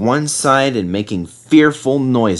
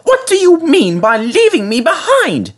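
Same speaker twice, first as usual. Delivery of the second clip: much higher lady's voice, demanding and louder; demanding refreshment lady; 4 dB louder than usual. A narrator's reading. Refreshment lady scolding Peter Sam for leaving her, demanding. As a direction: play the indignant tea lady. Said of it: "she demanded".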